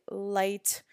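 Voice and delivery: usual speaking voice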